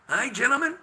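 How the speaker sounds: ordinary talk, nothing marked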